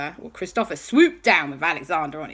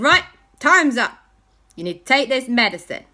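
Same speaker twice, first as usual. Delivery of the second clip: brisk announcement; 7 semitones higher, 7 dB louder than usual. Acted. Aunt calls time on the fun.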